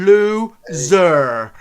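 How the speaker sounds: loud, high drawn-out taunt; sing-song taunt. Acted. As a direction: drag it out to needle them. Crank taunts.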